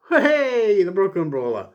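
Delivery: high loud cheer; a sudden high, loud cheer; excited. Real, not acted. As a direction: hail him like a crowd favourite. Cheering a jobber's name.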